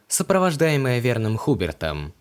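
ordinary delivery, nothing marked

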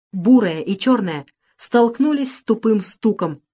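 plain reading voice

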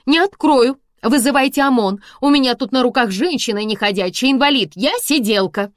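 loud, high, bold declaration; bold defiance. A narrator's reading. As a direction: refuse boldly through the door. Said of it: "объявила смело Света".

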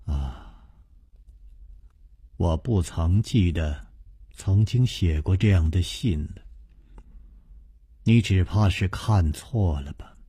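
low, soft and detached denial; offhand. A narrator's reading. Madam Ren coolly denies writing the letter, low and soft. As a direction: brush the question off coolly. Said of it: "淡淡地说".

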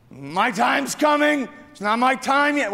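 the man insists his time will come; stubborn hope. Acted.

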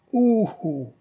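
high, wincing ouh of distaste; disgusted grimace noise. A narrator's reading. Belle-Rose tasting the cognac, grimacing ouh of distaste. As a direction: pull a face at bad cognac. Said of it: "dit-il en faisant la grimace".

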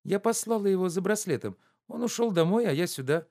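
high, breezy offhand reply; high carefree answer; careless offhand. A narrator's reading. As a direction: toss it off lightly. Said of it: "небрежно отвечала она".

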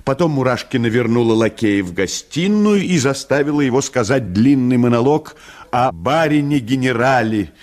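ordinary delivery, nothing marked

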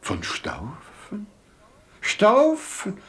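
slow, wondering repetitions, jumping high; struck by the name. Acted. Nathan hears the knight's name, struck and wondering. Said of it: "Warum fällt euch das so auf?".

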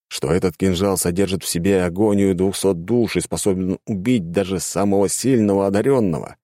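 plain reading voice

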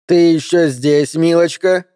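lightly acted condescension; mock-sweet needling. A narrator's reading. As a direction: feign sweetness to needle the listener. Ann needles the stalling operator.

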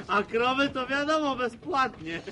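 loud, breaking into laughter; amused quip. Acted. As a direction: deliver the joke loudly, then laugh. Man joking about bathing cows.

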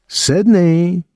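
high, drawn-out name; taunting lift on "Sidney"; mock sternness. A narrator's reading. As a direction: stretch "Sidney" out high to needle him. Boy mockingly echoes the girlfriend's name.

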